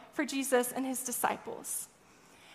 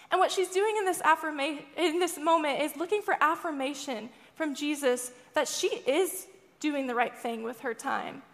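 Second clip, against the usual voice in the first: normal talking voice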